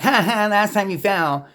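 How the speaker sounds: high, slow sneering "Ha ha, last time you fell"; mocking taunt. Warning a friend about a step, mocking not caring. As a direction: gloat over their fall with a nasty laugh. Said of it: "it wasn't because I'm like".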